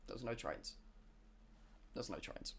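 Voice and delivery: very soft, low, near-whisper; deflated hush. Acted. Traveler told his train plan is dead, deflated hush. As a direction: drop to a crushed, barely audible murmur.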